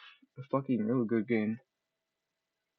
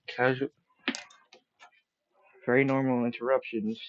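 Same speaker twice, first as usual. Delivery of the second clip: ordinary delivery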